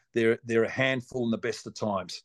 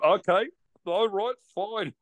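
stunned acceptance; 9 semitones higher, slower than usual. Retold or imitated. Stunned after being hung up on.

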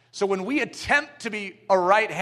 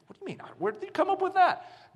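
hammed-up puzzled voice, much higher; playful raised-pitch disbelief; mock surprise; 11 semitones higher, 4 dB quieter, faster than usual. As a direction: act baffled at the rule. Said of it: "And the hand goes".

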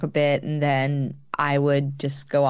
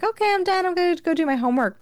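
high, quick voice for her younger self; imitated breezy excuse; 13 semitones higher, faster than usual. Daughter excusing herself from the dinner table, imitated high and hurried. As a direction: toss off the excuse in a put-on high pitch.

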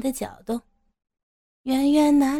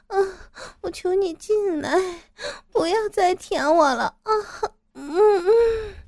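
high, whiny, moaning female character voice; pouting plea; 9 semitones higher than usual. Acted. Woman begs her teasing lover, pouting plea. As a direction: beg with an audible pout. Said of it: "撅着嘴说".

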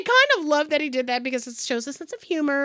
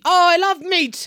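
a touch of an Irish lilt, playful; hint of Irish. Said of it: "can he have an Irish accent".

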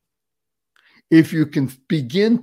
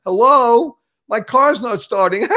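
mock alarm; 6 semitones higher, 4 dB louder than usual. Acted panic car won't start.

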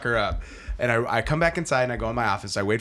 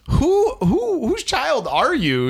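high, loud incredulous question; mock disbelief; 12 semitones higher, 4 dB louder, much slower than usual. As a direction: play the amazed dad.

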